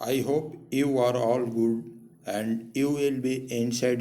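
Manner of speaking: very slow, spelled-out teacher talk; slow deliberate greeting. Shown as a demonstration. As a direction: speak each word slowly to children. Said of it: "slow greeting to children".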